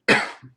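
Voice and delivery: dry sick cough. Real, not acted. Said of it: "Pardon the coughing".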